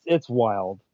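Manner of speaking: ordinary talk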